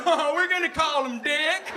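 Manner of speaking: high, slow, laughing proud parent; a giddy new dad naming his son. Acted. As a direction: beam with pride over the baby name.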